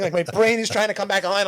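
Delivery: usual speaking voice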